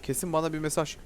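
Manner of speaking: normal talking voice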